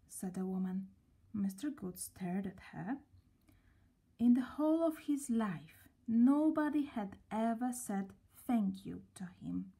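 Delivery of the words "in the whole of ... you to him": ordinary delivery, nothing marked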